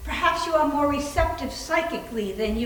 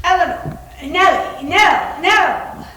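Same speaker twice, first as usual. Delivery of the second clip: louder, higher, each name pressed again; ghostly repeating; 4 semitones higher, 8 dB louder, slower than usual. Acted. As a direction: chant the name like a spirit. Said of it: "They repeat a word over and over".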